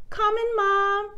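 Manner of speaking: put-on high child's call; calling back. A narrator's reading. Susie answers mom as she skips inside, calling back. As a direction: answer mom from across the yard.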